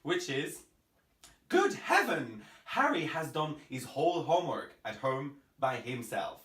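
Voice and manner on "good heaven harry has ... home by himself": tongue twister with every H sounded in "heaven", "Harry", "homework"; every H pronounced. Shown as a demonstration. Teacher demonstrates an H tongue twister, every H sounded in "Harry", "whole", "homework". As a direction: sound each H in "his whole homework at home by himself". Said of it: "I have pronounced every H in the sentence".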